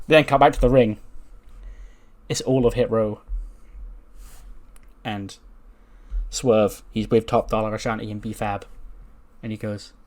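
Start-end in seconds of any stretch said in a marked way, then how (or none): none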